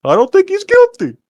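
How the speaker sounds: very high, squeaky mock juror; squeaky mocking take on the juror; mocking impression. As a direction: parody him in a silly high voice. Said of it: "That's how he said it".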